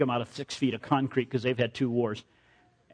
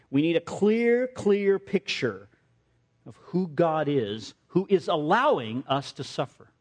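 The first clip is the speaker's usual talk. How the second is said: preaching; 2 semitones higher than usual. Acted. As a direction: drive the point home to the congregation. Preaching application.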